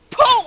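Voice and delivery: loud explosive boom; spoken impact noise. Acted. Describing his thrust, a shouted boom. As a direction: punch out a sudden boom.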